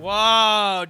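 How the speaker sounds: loud, sudden shocked whoa; surprised whoa. Real, not acted. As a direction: blurt it out in shock. Shocked at a friend's remark.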